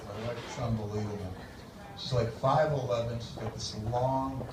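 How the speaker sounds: soft, low, lingering description; admiring husband. Acted. Young man describes wife to stranger, hushed admiration. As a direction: drop low and quiet, savouring each detail.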